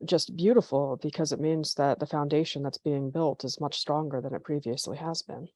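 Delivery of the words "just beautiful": ordinary talk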